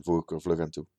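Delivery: ordinary delivery, nothing marked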